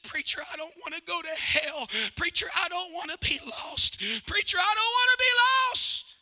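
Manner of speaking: building from pleading to a high loud shout. Retold or imitated. Grandfather begging the preacher for salvation, rising to a shout. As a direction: plead harder each time.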